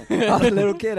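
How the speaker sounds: high, loud, laughing protest; loud laughing excuse. Real, not acted. Laughing while telling.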